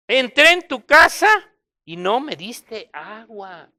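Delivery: loud then slow, pointed reproach; Jesus rebuking his host, rising then slowing; voicing Jesus. Jesus reproaching Simon, loud then slow. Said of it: "dijo a la mujer".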